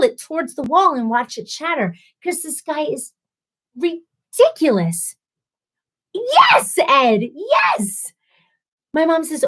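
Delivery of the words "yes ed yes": high, loud, drawn-out yes; emphatic agreement. Real, not acted. Agreeing with a viewer about the ridiculous guy, emphatic cry.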